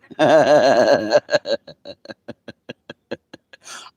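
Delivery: loud, helpless high laughter; hysterical laugh. Real, not acted. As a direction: let the laugh run away with you.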